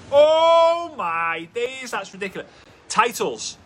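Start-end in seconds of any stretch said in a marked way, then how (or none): 0.1-2.5 high, slow, gasping mock amazement; amazed viewer. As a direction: play a stunned fan reacting to the engagement. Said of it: "just be like".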